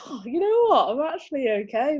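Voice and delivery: high-pitched retold relief; lightly hammed-up, high, pleasantly surprised; retold pleased surprise. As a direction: act out finding you're fine after all. Retells her pleased surprise afterwards.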